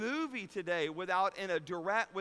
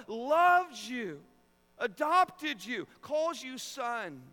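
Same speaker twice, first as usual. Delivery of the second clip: high, loud, driving sermon build; impassioned preaching; 7 semitones higher, 6 dB louder than usual. Real, not acted. Preacher proclaiming to congregation who God is, impassioned and loud. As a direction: pile up the titles with rising fervor. Preaching at rising full force.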